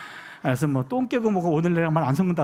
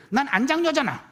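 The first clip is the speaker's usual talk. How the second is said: higher-voiced mock childish grievance; aggrieved little child; 6 semitones higher than usual. Second daughter protests to dad about not being eldest, imitated aggrieved child. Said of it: "자기는 인생이 서럽대".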